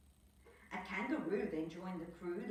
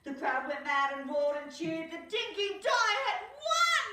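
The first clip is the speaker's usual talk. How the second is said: high, much louder triumphant finish; 10 semitones higher, 11 dB louder than usual. A narrator's reading. Narrator announcing the victory, high loud triumph. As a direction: proclaim the win.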